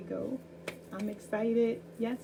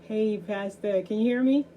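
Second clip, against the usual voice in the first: calling out; 3 semitones higher, 8 dB louder than usual. Real, not acted. Host calling over bad connection.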